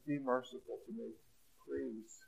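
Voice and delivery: soft, quiet plea for mercy; a gentle hint of a begging young woman; pleading girl. Guilty young woman pleading with the judge, softly. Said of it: "Maybe she's thinking".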